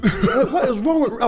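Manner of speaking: high, loud laughing outburst; laughing exasperation. Real, not acted. Friend venting to host about the rude guest, laughing exasperation. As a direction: blurt it out, half laughing, half fuming. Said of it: "I'm still mad".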